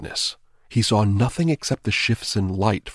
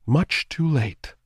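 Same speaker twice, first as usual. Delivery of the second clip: slow, higher eerie drawn-out doom; slow ghostly taunt; 7 semitones higher, 3 dB louder, slower than usual. A narrator's reading. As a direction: draw it out, savoring it. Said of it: "she told him".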